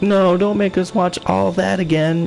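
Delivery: lightly voiced children begging off; protesting kids. Voices kids protesting rewatching a show.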